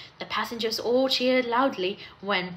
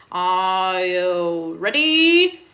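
long drawn-out, rising ready call; loud, slow starting call that lifts at the end; race starter's call; 4 semitones lower, 5 dB louder, much slower than usual. A narrator's reading. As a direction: hold each word before the start. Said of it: "said the station master".

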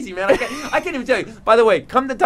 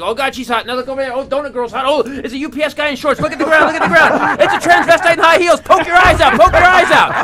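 panicked inner voice; 8 semitones higher, 9 dB louder than usual. Retold or imitated. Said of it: "Be like".

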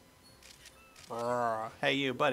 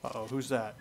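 usual speaking voice

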